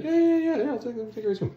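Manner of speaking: high, quick, overeager yeses; eager woman voice. Retold or imitated. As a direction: sell it too hard like a liar. Woman eagerly agrees to lead Conan.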